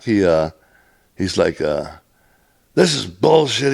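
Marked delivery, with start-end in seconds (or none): 2.7-3.7 high-pitched imitation of an angry boss; mock yell with outrage in it; retold yelling. Roadmaster coming back to the laborer, retold yelling. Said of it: "he's yelling at me".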